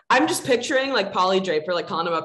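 ordinary talk, nothing marked

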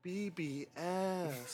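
slow, sultry drawn-out tag. Shown as a demonstration.

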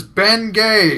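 high, loud flat repeat of the name. Retold or imitated. Old customer repeats to the clerk, loud. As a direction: say it again, loud and insistent.

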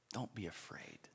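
whispered reassurance, unvoiced and soft. Shown as a demonstration. Preacher hushing the church: whispered don't be afraid. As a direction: breathe it like comfort. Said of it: "Do not fear".

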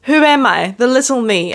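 ordinary delivery

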